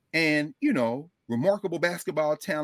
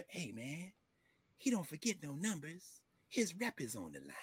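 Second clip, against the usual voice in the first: very soft, acted-out movie line; hushed warning; 14 dB quieter than usual. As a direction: warn him quietly, under your breath. Acting out movie scene's warning.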